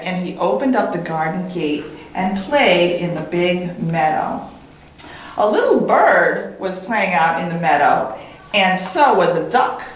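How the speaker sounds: ordinary delivery, nothing marked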